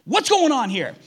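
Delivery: high, loud, indignant demand; indignant complaint. Retold or imitated. Martha to Jesus, complaining about her sister, indignant high loud demand. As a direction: snap at him like an overworked host. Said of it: "Martha has an attitude".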